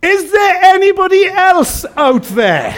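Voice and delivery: loud, high, drawn-out call; desperate shout. Acted. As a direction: holler past God for someone else. Man on cliff shouts for other help.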